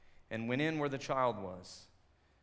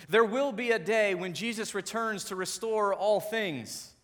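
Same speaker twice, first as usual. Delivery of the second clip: louder, higher soaring proclamation; preaching climax; 6 semitones higher, 5 dB louder than usual. Real, not acted. Pastor proclaiming Jesus' return to the congregation, soaring. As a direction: proclaim the coming day with full conviction.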